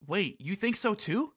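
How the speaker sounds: Sora. Acted. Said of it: "Sora says".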